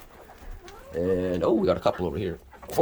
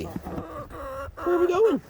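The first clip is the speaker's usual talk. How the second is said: high, very slow and stretched; high stretched question; drawn-out pet talk; much slower than usual. Acted. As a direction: drag it out teasing the bird. Hen following her.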